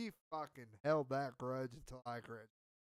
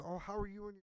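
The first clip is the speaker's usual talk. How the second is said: lightly voiced girl, higher pitch; retold girl's voice; 5 semitones higher, faster than usual. Girl asking about his relationship, lightly imitated. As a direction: go a bit higher for the girl's question.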